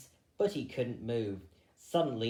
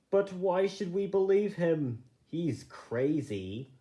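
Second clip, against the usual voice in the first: scornful doubt; 5 semitones higher than usual. A narrator's reading. Hawkwood doubts Fiver.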